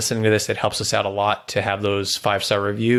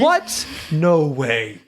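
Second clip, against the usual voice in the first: surprised; 5 semitones higher, much slower than usual. Real, not acted. Score of nine surprises host.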